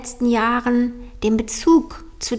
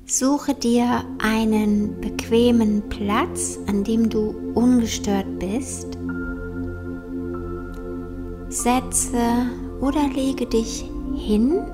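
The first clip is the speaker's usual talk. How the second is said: slow, soft meditation voice; guided meditation; 5 dB quieter, slower than usual. Acted. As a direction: guide them gently into calm, slowly.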